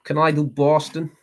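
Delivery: put-on high-pitched, unconvincing Boston accent; attempted Boston accent. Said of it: "I don't think I can do Boston".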